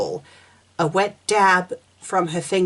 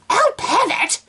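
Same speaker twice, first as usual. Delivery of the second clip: put-on shrill girl voice; 13 semitones higher, 4 dB louder than usual. Mocking reading of fanfic quote.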